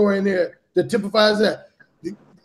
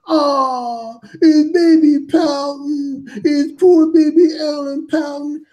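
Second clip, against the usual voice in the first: high, slow, babyish mock voice, louder; mocking taunt; 5 semitones higher, 3 dB louder, much slower than usual. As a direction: taunt him like a sulking child, drawn out. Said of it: "started taunting me".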